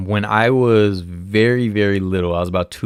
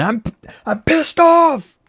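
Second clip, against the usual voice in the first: mock anger; 7 dB louder, slower than usual. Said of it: "Oh, I'm angry".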